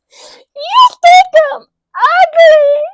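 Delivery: very high, loud, drawn-out wailing shriek; mock enraged scream. Rejected captor set off by captive, mock hysterical scream. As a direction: shriek it slowly like a hammed-up unhinged captor. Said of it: "And that set her off".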